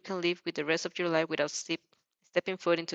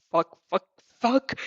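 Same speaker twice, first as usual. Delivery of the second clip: panicked cursing; 5 dB louder, slower than usual. A narrator's reading. Panicked inner swearing.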